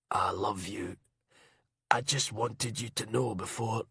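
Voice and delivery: soft, faint breathy farewell; spending his last breath; dying whisper. Acted. Dying Duncan's last words.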